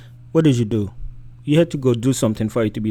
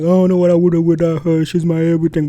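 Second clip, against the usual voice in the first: raised, louder mock of a smitten man; devoted man; 6 semitones higher, 6 dB louder than usual. Host mimics men who put a woman first, mock devotion. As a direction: imitate a man gushing over his woman. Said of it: "But whenever a man like".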